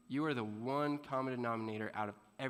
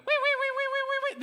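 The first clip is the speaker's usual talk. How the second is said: very high, loud siren wail; imitating the security alarm going off; 9 dB louder, faster than usual.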